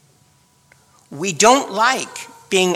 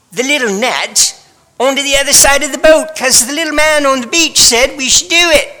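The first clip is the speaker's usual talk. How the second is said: high, genteel, overly polite fisherman; mock the prim church version with a dainty high voice; prim polite disciple; 6 semitones higher than usual. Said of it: "I do not believe that it went like this".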